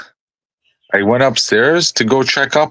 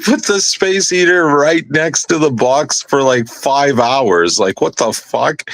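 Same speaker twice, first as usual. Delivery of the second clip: incredulous scolding; 7 semitones higher than usual. Retold or imitated. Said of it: "And I'm like".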